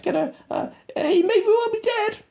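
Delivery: squeaky radio voice. Retold or imitated. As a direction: mimic a soldier on the radio, squeaky. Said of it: "they're talking on the radio to Murph".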